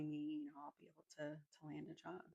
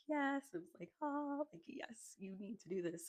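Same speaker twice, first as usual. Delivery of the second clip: inner yes; 7 semitones higher, 4 dB louder than usual. Retold or imitated. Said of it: "all my sensories were like".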